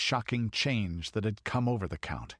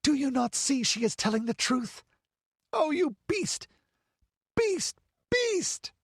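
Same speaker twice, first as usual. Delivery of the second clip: high, hysterical condemnation; frantic outrage building to a shriek; beside herself. A narrator's reading. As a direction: rail at him, climbing ever higher. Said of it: "almost beside herself".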